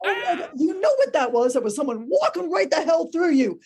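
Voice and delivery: thrilled at the haunting, talking to yourself; excited ghost-hunter. Retold or imitated. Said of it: "Ghost tour guide Monica is like".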